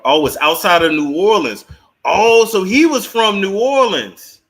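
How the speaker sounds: loud, high burst of surprise; surprised realisation. Real, not acted. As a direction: exclaim it loudly as it dawns on you. Discovers the family's home city.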